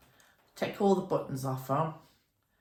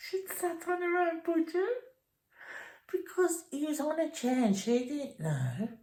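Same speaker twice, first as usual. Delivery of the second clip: higher, giggly voice just after a laugh; laughing disbelief; 12 semitones higher than usual. Real, not acted. Recalling Anne sitting on her budgie, higher amused voice. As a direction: squeak it out through amusement.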